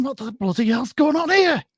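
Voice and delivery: high-pitched outraged patient; indignant joke patient. Acted. Patient in joke protests, northern voice.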